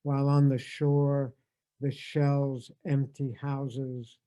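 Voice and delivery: slow, measured reading with long pauses; poem reading. Acted. Reading a poem again to bookend the tribute, measured poem reading. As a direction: savour each line of the poem.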